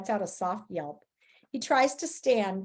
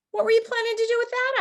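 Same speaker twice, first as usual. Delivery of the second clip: surprised question; 13 semitones higher, 3 dB louder, faster than usual. A narrator's reading. Amused question to friend.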